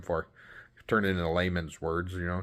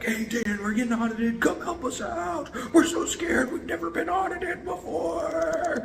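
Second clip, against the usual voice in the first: much higher, slow, hammed-up panic; mock scared client; slower than usual. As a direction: play the frightened client pleading for help. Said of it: "They're like".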